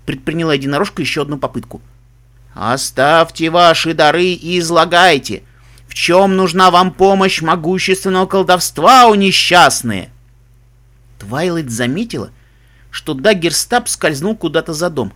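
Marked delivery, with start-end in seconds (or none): 2.5-10.1 slow, loud, ominous spirit voice; booming echoing voice. Acted. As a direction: boom it out like an unseen witch. Hidden witch speaking to visitors. (гулкий зловещий голос отовсюду)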